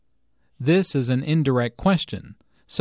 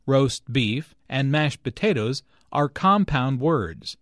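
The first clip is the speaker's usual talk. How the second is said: ordinary talk, nothing marked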